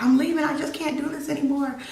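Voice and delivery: high, frantic plea; desperate cry. Retold or imitated. Fleeing, desperate.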